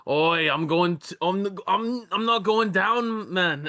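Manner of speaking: high, slow, hammed-up Scottish accent; a clumsy Scottish fighter accent; bad Scottish accent. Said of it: "I'm fucking horrible at that Scottish accent".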